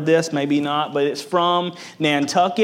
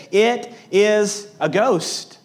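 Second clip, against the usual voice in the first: slow, higher-pitched retold cry of fear; terrified cry; 6 semitones higher, much slower than usual. Disciples seeing a figure on the sea, mildly terrified. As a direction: draw it out high, mildly terrified. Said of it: "they were terrified and said".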